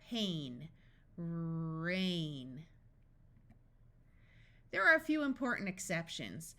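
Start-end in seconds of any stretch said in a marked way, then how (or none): 1.2-2.6 long held R gliding into "ain"; drawn-out phonics blending; sounded out. Shown as a demonstration. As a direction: stretch "Rain" slowly through its sounds.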